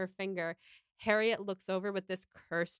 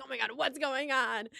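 higher, mock-alarmed question; 5 semitones higher than usual. Emma hears Missus Weston needs her, mock alarm. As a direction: play up the mock panic.

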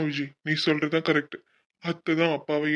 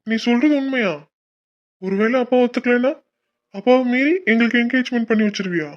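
higher, louder eager questions; excited hope; 6 semitones higher, 5 dB louder than usual. Real, not acted. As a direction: beg her eagerly to confirm. Son hopeful about engagement. (ஆர்வமான உற்சாகக் கேள்வி)

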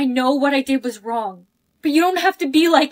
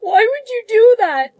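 upset outburst; 7 semitones higher, 5 dB louder than usual. Acted. Betrayed friend.

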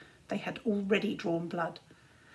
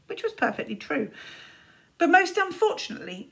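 ordinary delivery, nothing marked

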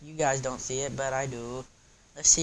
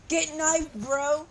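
gloating taunt; 13 semitones higher, slower than usual. Real, not acted. Taunting after a kill.

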